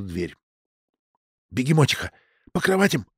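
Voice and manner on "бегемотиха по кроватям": ordinary delivery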